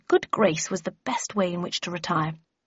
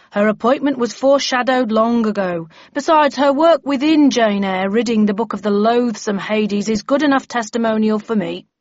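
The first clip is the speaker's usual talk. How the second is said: firm, raised official voice; bellman defending recruit; 3 semitones higher, 6 dB louder than usual. Acted. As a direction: overrule the objection with authority.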